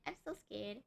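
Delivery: dropping to a soft murmur; quiet scared murmur. Real, not acted. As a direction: trail off softly, scared.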